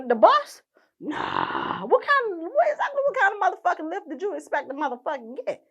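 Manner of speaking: ordinary talk, nothing marked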